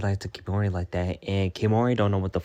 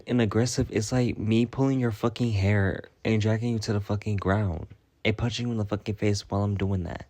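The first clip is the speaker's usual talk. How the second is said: ordinary talk, nothing marked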